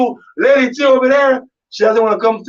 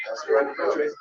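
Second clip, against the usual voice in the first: whispered aside; 7 semitones lower, 11 dB quieter, much faster than usual. Real, not acted. Beiseite geflüstert.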